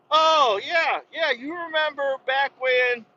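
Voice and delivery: high, slow, drawn-out recall; mock-excited old buddy; hammed-up reminiscing. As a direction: reminisce in a high phone-call voice. Acted phone call, reminiscing.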